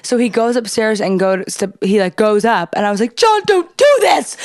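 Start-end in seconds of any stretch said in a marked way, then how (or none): 3.2-4.4 high, drawn-out mock plea; retold panicked begging; freaking out. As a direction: wail it like a hammed-up desperate plea. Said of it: "I'm freaking out".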